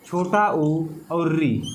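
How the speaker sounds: छोटा ऊ और री spoken slowly, each vowel drawn out; slow vowel demo. Shown as a demonstration. Teacher to students, slowly listing the short vowels. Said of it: "एक बचता है छोटी ई".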